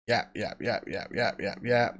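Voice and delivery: low, soft string of yaps; mock yapping. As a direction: mimic endless chatter under your breath. Mimics her nonstop talking.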